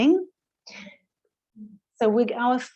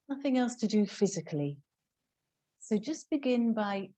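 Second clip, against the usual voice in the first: softer, settling yoga teacher voice; guided relaxation; 6 dB quieter than usual. Real, not acted. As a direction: ease them down gently, with long pauses.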